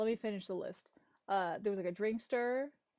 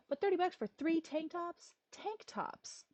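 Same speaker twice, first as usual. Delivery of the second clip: outrage; 8 semitones higher than usual. Real, not acted. Outraged rant on prices.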